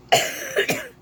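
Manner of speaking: sharp loud cough to clear the throat; real cough. Real, not acted. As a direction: cough hard to clear a tickle. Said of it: "So sometimes I just cough".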